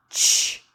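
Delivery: isolated ch sound, unvoiced. Shown as a demonstration. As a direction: say ch alone, crisp and breathy. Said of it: "It says".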